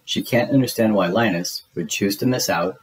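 usual speaking voice

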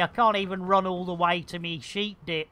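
ordinary delivery

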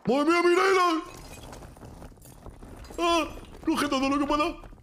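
high frantic yelling; frantic shouting. Real, not acted. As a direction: shout in the heat of the fight. Frantic fight moment.

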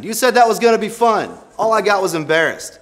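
high, loud whine; loud mock complaint. Real, not acted. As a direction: whine at the mascot. Complaint to mascot.

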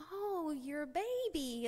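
slow, soft, high and touched; mother gently echoing her toddler's words; tender. Retold or imitated. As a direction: savor it, moved by her child.

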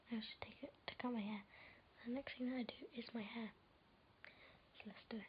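quiet unvoiced whisper. Real, not acted. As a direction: whisper so the house stays asleep. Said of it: "I'm trying to be quiet".

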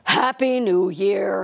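low rumbling Santa voice. Acted. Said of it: "he rumbled".

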